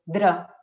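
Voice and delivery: "dra" with a D leading into a tapped R; DR replacing trill. Shown as a demonstration. Speech therapist isolates the child's substitute, DR sound. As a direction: say "dra" as a near miss for a trilled "ra". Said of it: "en vez de ra".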